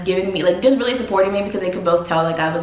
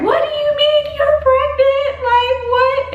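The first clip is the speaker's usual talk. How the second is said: very high-pitched shocked squeal, imitating mom; mom freaking out; 4 dB louder than usual. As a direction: mimic a mother's high, drawn-out disbelief. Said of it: "she was yelling at me".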